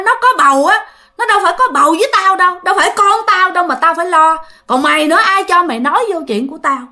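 high, heated retort, acted out. Acted father retorting angrily to mother. (giọng con trai hỗn hào cãi lại mẹ)

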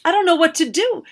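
high, loud panic; frightened girl pleading with the wizard, high; panicked plea. A narrator's reading. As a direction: blurt it out in panic.

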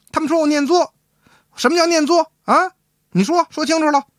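high, indignant demand for an answer; acted high-pitched outrage; wife turning angry. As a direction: play the cross wife pressing her husband. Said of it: "可跟他翻车了".

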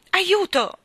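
high, loud, alarmed shout; cry for help. A narrator's reading. Postman spinning helplessly, alarmed cry for help. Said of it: "gridò continuando a piroettare".

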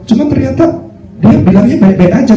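very high worried whisper-talk; alarmed insinuation. Acted. Devil insisting the girl is dying, very high alarmed tone. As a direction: stir up his fear.

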